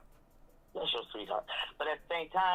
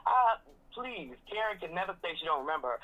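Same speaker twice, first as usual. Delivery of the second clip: ordinary delivery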